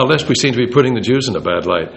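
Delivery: normal talking voice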